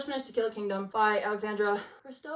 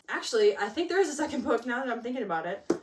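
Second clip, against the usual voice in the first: normal talking voice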